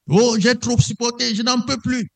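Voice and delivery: high-pitched, strained mock outburst; mock crying out. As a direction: cry out in exasperation, imitating a complainer. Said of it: "Et il crie, et il crie, et il vocifère".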